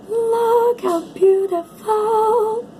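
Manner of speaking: drawn-out adoring coo. Real, not acted. Reader marveling at a card, high slow adoring coo. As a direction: savor every word in delight.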